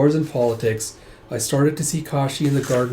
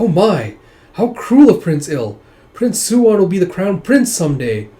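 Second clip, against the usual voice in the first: higher, louder acted dismay; scandalized; 6 semitones higher, 8 dB louder than usual. Woman deplores Prince Il's cruelty, scandalized. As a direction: exclaim as if shocked.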